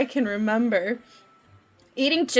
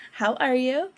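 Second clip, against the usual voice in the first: ordinary talk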